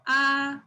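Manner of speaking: "ah" in first tone, no rise or fall. Shown as a demonstration. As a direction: say "ah" on one steady, unchanging pitch. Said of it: "no change".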